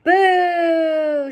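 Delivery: loud, high jeer; shouted boo. Acted. Botticelli booing Roscuro's tears, loud jeer. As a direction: jeer him down. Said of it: "shouted Botticelli".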